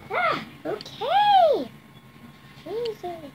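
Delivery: high squeaky slime voice; slime character voice. Pretend. Kid voicing her slime toy, squeaky high voice.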